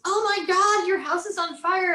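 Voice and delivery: high, loud retold panic; alarmed friend. Girlfriend phoning about the burning house, mock alarm. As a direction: imitate a frantic caller.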